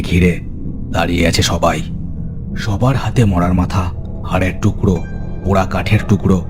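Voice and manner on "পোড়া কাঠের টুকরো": ordinary delivery, nothing marked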